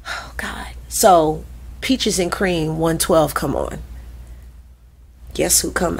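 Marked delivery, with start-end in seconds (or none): 0.1-0.7 breathless whisper; whispered dread. Retold or imitated. Dancer panicking before her battle, whispered. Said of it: "So I'm like".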